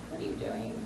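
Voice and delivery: whispered self-question. Retold or imitated. Comic asking herself about her life in a whisper. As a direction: breathe it out with no voice. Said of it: "I'm like".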